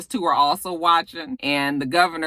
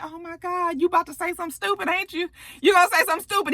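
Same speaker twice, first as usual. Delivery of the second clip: exasperated; 9 semitones higher, faster than usual. Retold or imitated. Dreading Nate's answer.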